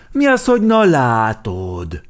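loud, high, drawn-out challenge; indignant. A narrator's reading. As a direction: throw the phrase back in protest. Indignant echo of the smug reply.

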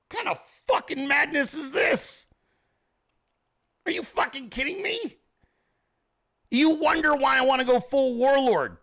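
high-pitched incredulous rant; indignant, high and strained; exasperated outrage. Acted. Incredulous angry rant at wife's reply.

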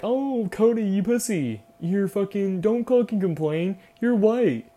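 high, loud mocking voice; shrill mock of online haters; mocking critics. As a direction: sneer it like a whiny critic. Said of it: "everyone's gonna be like".

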